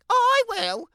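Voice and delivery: put-on a sweet, self-admiring child's voice; vain little girl. Little Red voiced by narrator.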